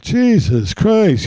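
mock exasperation. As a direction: imitate an exasperated relative, stretching it out. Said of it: "he did the old".

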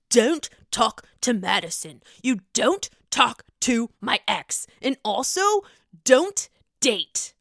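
lightly voiced controlling man giving orders; a hint of a bossy boyfriend laying down rules; mock bossy boyfriend. Mocking Austin ordering Olivia around, lightly bossy. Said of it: "Austin tried to do that".

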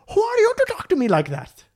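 put-on very high, affronted Indian-accented voice; high-pitched mock indignation; outraged man imitation. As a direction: turn back to demand respect, hammed up. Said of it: "raising his voice a lot".

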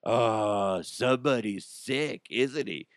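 slow mocking singsong tease; teasing kid voice. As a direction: taunt like a cheeky kid. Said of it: "he's like".

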